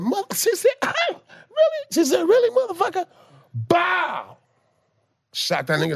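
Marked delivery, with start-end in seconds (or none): 0.0-3.1 high mock-sweet mama voice. Mama taunting the man who hit her son, high mocking voice. 3.5-4.4 high popped gunshot sound; imitated gunshot. Teller acting out mama's shot, imitated gunshot.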